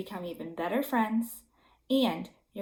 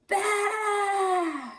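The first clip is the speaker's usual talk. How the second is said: big mad baa; 10 semitones higher than usual. A narrator's reading. Goat bleating his anger in the meadow. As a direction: bleat out all the rage. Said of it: "let out a big mad".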